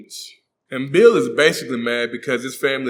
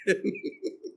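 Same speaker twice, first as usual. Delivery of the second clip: high laugh; 10 dB quieter than usual. Real, not acted.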